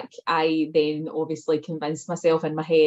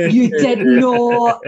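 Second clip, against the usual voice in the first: shocked disbelief; 4 semitones higher, 9 dB louder, much slower than usual. Real, not acted. Shocked reaction to news.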